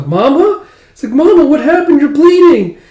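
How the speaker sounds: put-on high child voice, loud and alarmed; a small girl panicking over her mother; scared little girl. Yoko seeing Mama bleed, high frightened child voice.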